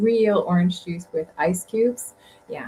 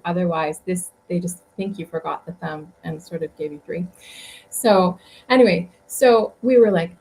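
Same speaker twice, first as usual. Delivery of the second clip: ordinary talk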